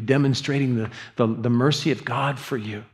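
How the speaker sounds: usual speaking voice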